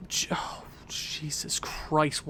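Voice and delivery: breathless whisper, slow and drawn out; stunned fan whispering at a bad goal; whispered dismay. Real, not acted. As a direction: whisper it in horror.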